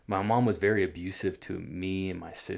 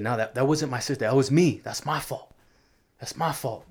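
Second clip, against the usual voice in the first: mild mock yell, pitched up, peaking on me; retold yelling; 6 semitones higher, 5 dB louder than usual. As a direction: replay the kid shouting to take the blame. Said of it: "I remember yelling at my mom".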